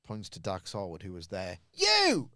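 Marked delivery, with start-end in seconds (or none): 1.7-2.3 sudden high yell of "You!"; shouted accusation. Retold or imitated. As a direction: bark it out, pointing. Sami pointing at Dax, shouted.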